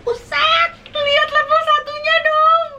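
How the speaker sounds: high, astonished exclamation; surprised. Real, not acted. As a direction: gasp at how red it is. Shocked at the red level one.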